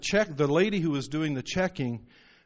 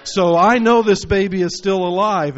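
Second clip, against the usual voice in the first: defiant retold declaration; 6 semitones higher, 11 dB louder than usual. Pregnant cashier telling the doctor her baby lives, loud defiant insistence. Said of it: "And she said".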